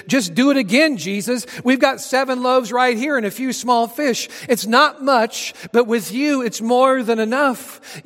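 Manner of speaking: high, loud, eager disciple's plea; eager urging. Acted. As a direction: urge him on with rising, confident excitement. Acting an eager disciple urging Jesus.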